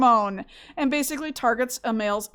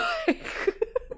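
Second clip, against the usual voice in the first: high giggle; 6 dB quieter than usual. Real, not acted. Laughing at husband's answer.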